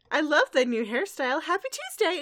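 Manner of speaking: high, chirpy small talk; breezy princess. Retold or imitated. Recapper plays a princess strolling past, breezy. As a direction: toss out cheery pleasantries.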